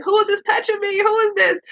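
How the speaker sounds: put-on high, whiny little kid voice; mother imitating her son crying at a stranger; crying toddler. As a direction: mimic a scared toddler who doesn't know his mom. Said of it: "and he'll cry, like".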